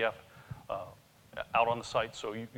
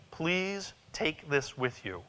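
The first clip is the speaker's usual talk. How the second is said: ordinary delivery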